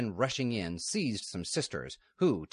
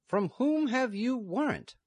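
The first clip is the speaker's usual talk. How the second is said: much higher, slow, challenging question; stern nun; 6 dB louder than usual. Acted. Lady Superior questioning men at the gate, high and stern. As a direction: demand the warrant with cold authority. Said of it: "the Lady Superior asked".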